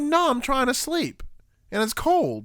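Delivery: high-pitched exasperated plea; re-enacted tired, high whine; whining protest. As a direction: protest like a dad denied sleep. Dad protesting being tickled.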